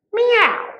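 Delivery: loud, very high short meow; little cat. A narrator's reading. Cat answers after thinking, loud high meow. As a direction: yowl one quick high meow. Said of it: "and thought and said".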